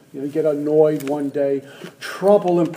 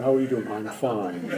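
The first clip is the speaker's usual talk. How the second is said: much lower and softer, serene and holy; put-on spiritual voice; 7 semitones lower, 7 dB quieter than usual. Alcoholic at a meeting faking calm, low serene voice. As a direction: drop the voice down to sound spiritual. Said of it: "so the voice goes down to about here".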